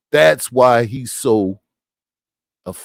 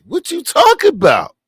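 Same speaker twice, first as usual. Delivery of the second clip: incredulous; 13 semitones higher, 4 dB louder than usual. Real, not acted. Incredulous rhetorical outburst.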